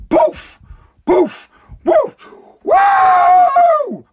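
high, loud poofs into a long whoop; cartoon vanishing noises; mock magic poof. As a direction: make it vanish with a drawn-out high woo. Mimes covid vanishing by magic.

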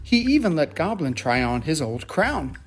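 plain reading voice

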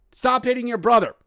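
high, loud parental order; acted parent breaking up a squabble; scolding parent.

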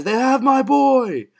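high-pitched dramatic shout; Tommy's outburst. Acted. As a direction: act out a desperate shouted plea. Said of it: "when Tommy says".

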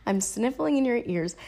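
usual speaking voice

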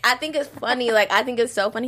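ordinary talk

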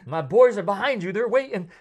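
high, loud comic menace; mock threatening shout. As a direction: holler it as a hammed-up warning. Acted Bigfoot threat.

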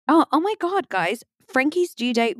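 high, startled oh my God; sudden surprise. Real, not acted. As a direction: jolt of realisation. Sudden realisation mid-story.

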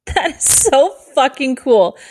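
thrilled. Real, not acted. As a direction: gush that it's so fucking cool. Thrilled by listeners on three continents.

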